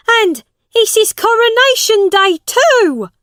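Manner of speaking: high, loud exclamation; calling out. A narrator's reading. Second onlooker joins in about the king, calling out. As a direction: pipe up loudly from the crowd.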